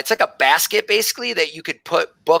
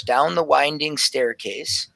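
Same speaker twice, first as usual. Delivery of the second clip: ordinary talk, nothing marked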